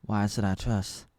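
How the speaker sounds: a distrustful dwarf king, weighing each word. Retold or imitated. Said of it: "So the dwarf king is like".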